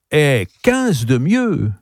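high, slow, stretched-out exclamation; emphatic correction. A narrator's reading. Charles correcting Zazie's guess of his age, emphatic. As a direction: top their guess, drawing the words out.

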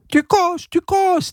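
very high, strange parrot squawk; shrill cartoon parrot mocking. A narrator's reading. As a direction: screech it like a talking parrot. Parrot speaks.

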